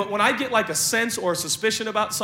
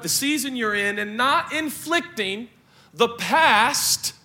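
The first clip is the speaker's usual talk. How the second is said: ordinary delivery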